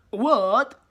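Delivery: loud, high, outraged "What?"; freaking out. Retold or imitated. Teacher freaking out at the student's screen, loud. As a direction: blurt it in disbelief.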